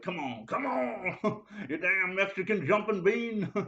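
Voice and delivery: a mock taunt drawn out slowly, half laughing; playful brotherly teasing. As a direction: rib your little brother just for fun. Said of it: "I'd be like".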